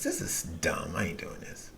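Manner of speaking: whispered, self-disgusted aside; hushed whisper, ashamed; whispered to himself. Retold or imitated. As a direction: breathe it out as a private whisper of regret. Regret after phone sex, retold.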